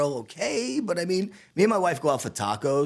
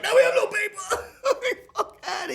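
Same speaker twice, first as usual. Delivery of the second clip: panicked cook. Retold or imitated. Cook panicking over the empty printer, high mock squeal. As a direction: squeal it like the sky is falling. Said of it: "I'm like".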